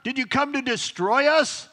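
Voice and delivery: loud, high demon's cry. Acted. Demon confronting Jesus as he teaches, crying out. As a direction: shout it out, acting a possessed man. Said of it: "crying out to him and telling him".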